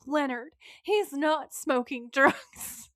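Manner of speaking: slow, emphatic mock reproach; mom's scolding. Gary's mom correcting her husband, slow exasperated scolding. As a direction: draw it out, chiding a husband. Mom rebukes dad's question.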